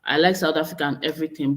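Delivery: ordinary delivery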